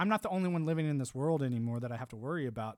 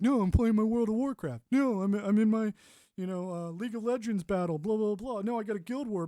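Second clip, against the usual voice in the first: higher, louder put-on nerd voice; mock whiny gamer; 6 semitones higher, 5 dB louder than usual. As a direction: whine like a gamer who won't leave the screen. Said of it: "they were like".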